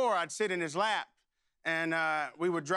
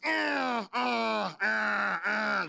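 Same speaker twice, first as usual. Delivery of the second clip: imitated gear grinding; 2 semitones higher, much slower than usual. Son struggles to shift into second, imitated grinding noise. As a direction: mimic gears refusing to catch. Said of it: "I'm grinding gears".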